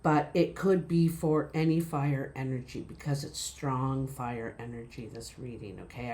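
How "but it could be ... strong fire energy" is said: ordinary talk, nothing marked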